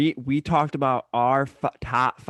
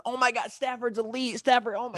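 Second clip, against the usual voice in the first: high gushing fan voice; mock adoration; 11 semitones higher than usual. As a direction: gush like a bandwagon fan. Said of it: "all these people are coming out of the woodwork".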